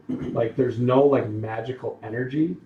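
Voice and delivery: normal talking voice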